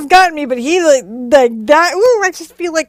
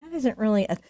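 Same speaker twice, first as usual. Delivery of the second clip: ordinary delivery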